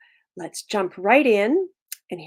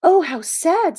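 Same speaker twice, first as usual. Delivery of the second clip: put-on high, louder boy voice full of pity; sympathetic Timothy; 9 semitones higher, 9 dB louder, slower than usual. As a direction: exclaim with a child's sympathy. Timothy pities the lonely dragon.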